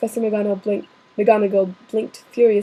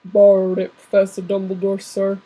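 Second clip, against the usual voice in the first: a lower, louder giant's voice for "Borrowed it"; Hagrid voice; 3 semitones lower, 3 dB louder than usual. Acted. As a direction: rumble it out like the big gamekeeper. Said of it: "How do I do a Hagrid voice?".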